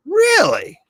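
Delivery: very high, loud disbelief; incredulous. Real, not acted. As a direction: shoot the pitch up in disbelief. Mock disbelief at article claim.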